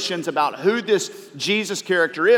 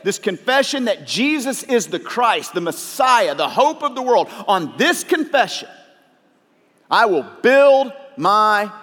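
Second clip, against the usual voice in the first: rising, louder preacher read of the verses; preaching scripture; 5 semitones higher, 4 dB louder than usual. Acted. Pastor reads Matthew sixteen to the church, building up. Reads Peter and Jesus from the Bible.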